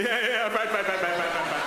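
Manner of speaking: high, rapid cheerful agreeing; chirpy repeated agreement; eager agreement. Retold or imitated. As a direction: nod along eagerly.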